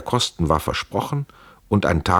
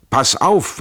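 hard, raised warning, high and loud; angry warning; 8 semitones higher, 4 dB louder than usual. A narrator's reading. Trenck warns his comrade sharply, raised and angry. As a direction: snap it out as a threat.

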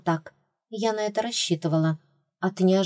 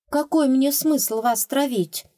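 baffled; 7 semitones higher, slower than usual. A narrator's reading. Heroine puzzled by rats' suspicion, baffled question. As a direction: ask it genuinely confused. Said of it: "не поняла я".

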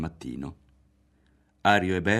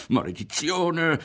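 slow, higher drawn-out curse; exasperated curse; 9 semitones higher, slower than usual. A narrator's reading. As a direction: spit out the frustration. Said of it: "esclamò Berto". (imprecazione esasperata)

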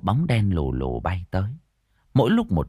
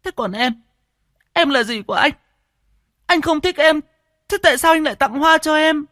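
high-pitched hurt pleading that grows louder; Siêu, tearfully accusing; 5 dB louder than usual. A narrator's reading. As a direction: build from wounded question to loud wail. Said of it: "cô Siêu lệ nóng khoanh tròn". (rưng rưng trách móc, the thé)

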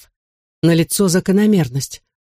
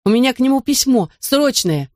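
urgent panting report; 8 semitones higher than usual. A narrator's reading. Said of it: "задыхаясь, выпалил Таспер".

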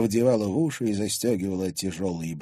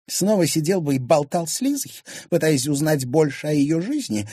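higher-pitched scornful jab; contemptuous wife; 7 semitones higher, 4 dB louder than usual. Acted. Wife mocking husband's chats with Лиза, contemptuous. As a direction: sneer at him in a high voice. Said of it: "презрительно скривила губы".

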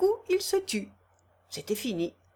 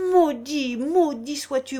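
loud, higher curse hurled at a father; cursing; 4 semitones higher, 5 dB louder than usual. Acted. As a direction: spit the curse out. Son curses father in sermon.

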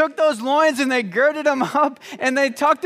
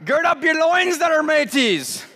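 high, laughing pirate voice; 5 semitones higher than usual. Pretend. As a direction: ham it up like a pirate. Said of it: "done in a pirate voice".

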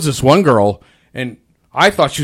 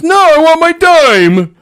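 high, slow whine; whining customer; 13 semitones higher, 6 dB louder, slower than usual. Retold or imitated. Cashier imitating a customer demanding a dime, whining slowly. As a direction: drag it out like a petulant child. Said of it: "Like, whining?".

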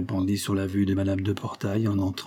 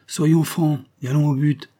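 higher, louder blunt cut-in; brutal interruption; 6 semitones higher, 5 dB louder than usual. Acted. A man at the table's end cuts in bluntly. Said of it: "interrompit brutalement".

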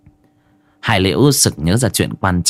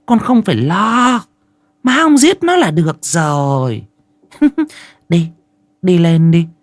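high-pitched, bossy mother voice; dismissive mother; 8 semitones higher than usual. Acted. Mother sending her son upstairs, dismissive and bossy.

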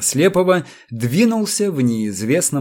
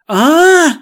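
much higher, loud falsetto yell; falsetto shriek; 7 dB louder than usual. A narrator's reading. Sherkhebel reading the fatal document, falsetto shriek. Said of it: "фальцетом вскричал он".

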